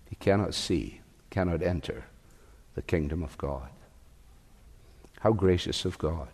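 low, soft, reverent reflection; quiet, measured awe; hushed sermon close. Real, not acted.

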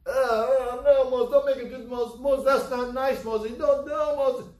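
high, loud whining protest; hammed-up complaining crowd; protesting Israelites. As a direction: plead in a high mock panic.

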